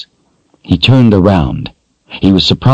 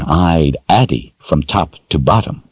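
ordinary delivery

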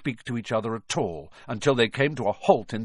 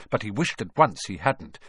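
usual speaking voice

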